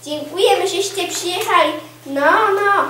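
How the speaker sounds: lively thanks to arriving guests; effusive welcome. Acted. Hostess greets unexpected guests.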